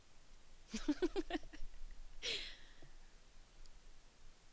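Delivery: high breathy laughter fit; high laughing fit. Real, not acted. Bursts out laughing.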